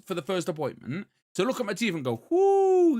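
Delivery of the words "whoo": high, loud, drawn-out "whoo"; a big mock reaction, pitched right up; imitated whoo. Dentist looking at the patient's teeth, imitated high loud whoo.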